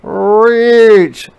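loud high "Reach!"; calling out. A narrator's reading. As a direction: call out to the spider. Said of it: "called the beetle".

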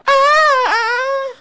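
piercing falsetto "ah"; high sung wail. Pretend. Gamer belting out a theme song, falsetto wail. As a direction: hold a very high wailing note.

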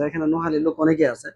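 ordinary talk

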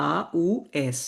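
normal talking voice

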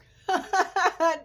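a loud burst of real laughter, pitched high. Real, not acted. Laughing at the lawn crew after imitating them, a loud high laugh. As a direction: break into a big high laugh.

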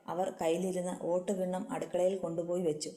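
usual speaking voice

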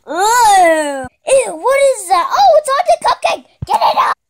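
loud, high squeal of disgust; disgusted shriek, played up; grossed-out outburst. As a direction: recoil loudly, pitch jumping up. Grossed out by something on cupcake.

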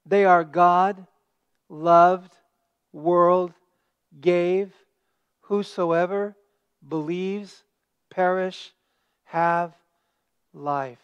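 slow, each word set apart; key words listed. Shown as a demonstration. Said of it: "nine other key words".

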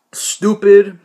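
angry outburst at the viewers; loud. Real, not acted. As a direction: fling the insult out. Ranting at trolling viewers.

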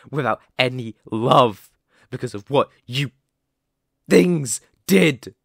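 loud, building anger at the captive; bitter accusation. Acted. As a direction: hurl the blame, rising to a loud finish. Accuses humans of destroying the pack.

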